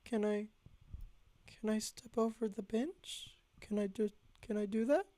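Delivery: a small scared request; timid high plea. Retold or imitated. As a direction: beg the killer politely. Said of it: "and I go".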